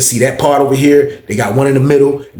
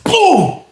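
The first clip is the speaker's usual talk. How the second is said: high-pitched blast sound effect; imitated gunshot boom. Storyteller showing the inmate got hit, high boom. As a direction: pop it out high.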